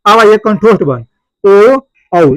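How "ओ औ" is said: ओ and औ held long and loud; throat-lip vowel demo. Shown as a demonstration. Teacher demonstrating throat-lip vowels, loud drawn-out ओ, औ. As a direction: round the lips and sound out ओ, then औ. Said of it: "कंठ और ओष्ठ से मिलकर".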